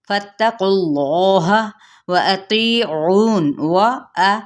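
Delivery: very slow chant, अतीऊन stretched long; slow recited demo. Shown as a demonstration. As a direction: recite it slowly as a model for the students. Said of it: "Hamza की ज़बर को अदा करते हुए". (बहुत धीमी तिलावत)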